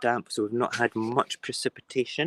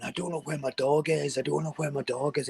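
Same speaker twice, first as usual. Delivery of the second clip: retold shouting; 4 semitones higher than usual. Retelling neighbour shouting during the siege, mock shouting. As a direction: imitate a man shouting without real volume. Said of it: "he's shouting".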